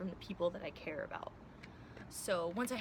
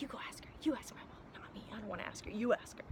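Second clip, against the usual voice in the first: put-on hushed childish whisper pushing a sibling to ask; child whispering to a sibling, don't make me ask mom; kid whispering; 6 dB quieter, faster than usual. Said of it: "when you're a kid and you're like".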